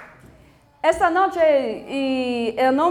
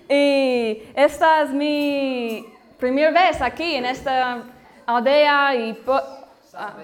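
normal talking voice

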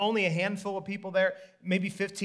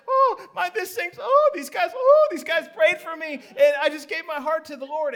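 loud, much higher, overjoyed outburst; excited shouting; 10 semitones higher, 8 dB louder than usual. Retold or imitated. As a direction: blurt it out to the wife, beside yourself with joy. Healed man excitedly tells his wife.

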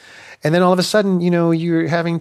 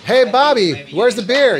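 high, loud holler across a room; calling out; 10 semitones higher, 6 dB louder than usual. Retold or imitated. Marines in the lobby yelling to Bobby, high loud holler. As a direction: imitate someone shouting over to a buddy. Voicing men waiting in lobby.

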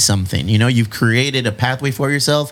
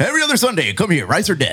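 laughing sales pitch, much higher; salesman voice; 6 semitones higher than usual. Acted. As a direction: hype it like an ad, laughing. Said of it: "my salesman voice whenever I try to promote".

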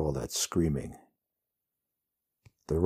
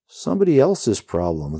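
humorless laugh; 6 semitones higher, 7 dB louder than usual. A narrator's reading. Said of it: "laughs humorlessly".

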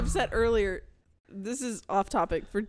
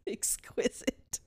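slow, soft, whispery mimicry; teasing whispered echo; 8 dB quieter, much slower than usual. Echoing the praise of the bunny cover, teasing whisper. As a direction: draw it out in a mocking hush. Mockingly echoes partner's word.

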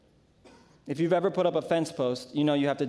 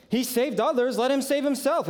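higher, sneering taunt at the cross; mild scoffing; 8 semitones higher than usual. A narrator's reading. Religious leaders jeering at Jesus, mock taunt. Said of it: "the leaders were scoffing".

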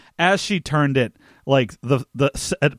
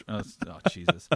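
ordinary delivery

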